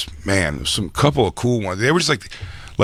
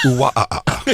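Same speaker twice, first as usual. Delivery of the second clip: silly ooh wah ah ah; hammed-up whining noise; mock wail; 4 semitones higher, faster than usual. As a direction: repeat the goofy whine.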